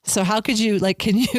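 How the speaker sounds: usual speaking voice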